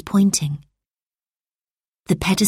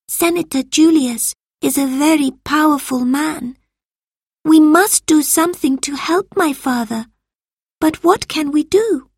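put-on worried boy voice; 10 semitones higher than usual. A narrator's reading. Marcus worried.

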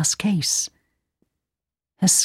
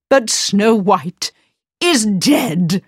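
slow, higher indignant queen; wicked queen; 3 semitones higher, slower than usual. Acted. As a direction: insist coldly like a wicked queen. Queen protests.

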